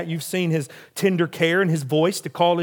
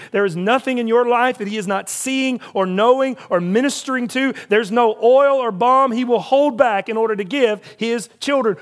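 rising preacher's build, a little louder and higher; fervent preaching; 5 semitones higher, 4 dB louder than usual. Acted. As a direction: preach it with growing fire. Climactic preaching passage.

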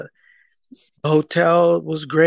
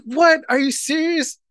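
high-pitched disbelief; incredulous; 10 semitones higher than usual. Retold or imitated. Husband reacting to wife making shirts for everyone, incredulous. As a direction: question it in a raised, incredulous voice.

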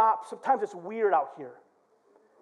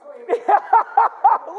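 high, loud cackling laugh; rapid helpless cackle; real laugh; 14 semitones higher, 9 dB louder than usual. Real, not acted. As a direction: burst out laughing. Still laughing at himself.

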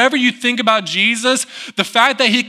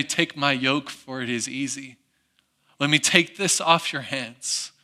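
gentle voice of Jesus; 7 semitones lower, 8 dB quieter than usual. Acted. Jesus voiced tenderly in sermon.

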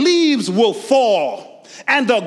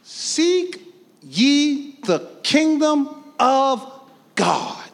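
high, slow modelled verse; modelled line; 6 semitones higher, much slower than usual. Shown as a demonstration. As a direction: show them how it should sound. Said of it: "Y'all say it like y'all don't understand it".

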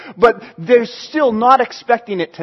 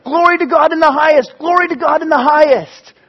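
higher, joyful proclaiming; excited praise; 5 semitones higher, 3 dB louder than usual. Acted. Angels singing together, mild excited proclaim. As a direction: call out praise gladly. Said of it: "start singing together".

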